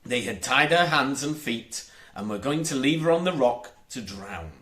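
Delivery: usual speaking voice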